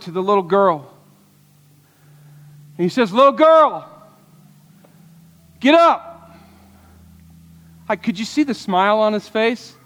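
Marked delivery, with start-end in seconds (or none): 3.1-6.4 high, loud call to rise; commanding Jesus. Acted. Jesus calls the dead girl to get up, loud and commanding. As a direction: raise her with a loud command.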